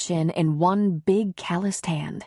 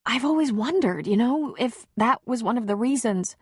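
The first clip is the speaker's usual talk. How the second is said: higher, lightly voiced woman confiding; heroine's voice; 6 semitones higher than usual. Heroine confessing old doubts to Connor, higher woman's voice. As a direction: raise the pitch into the heroine, vulnerable.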